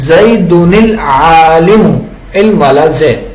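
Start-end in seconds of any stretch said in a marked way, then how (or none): 0.1-1.9 زیدن العالم read zaydunil-aalam, the nun taking a kasra; slow, careful zaydunil-aalam; tanween joined with kasra. Shown as a demonstration. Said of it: "ہو تو پڑھیں گے".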